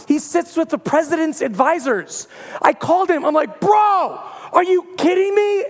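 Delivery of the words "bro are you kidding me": incredulous. Retold or imitated. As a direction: shout it in amazed disbelief. Disbelief on phone to friend.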